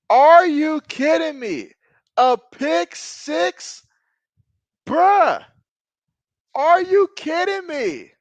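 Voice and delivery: high, loud cries of disbelief; stunned outcry. Real, not acted. Fan shouting at his team's interception, high and loud. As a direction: yelp it out in outrage at the screen. Pick six, live disbelief.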